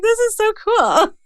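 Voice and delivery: high, giddy gush. Retold or imitated. Host gushing to her hero guest, giddy high voice. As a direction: squeal it like a thrilled fan. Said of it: "I'm just like".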